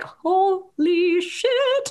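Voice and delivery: long drawn-out high gasp of shock; stunned. Retold or imitated. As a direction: stretch it out, floored. Stunned reaction while watching.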